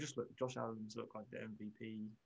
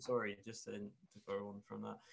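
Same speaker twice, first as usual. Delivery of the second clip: usual speaking voice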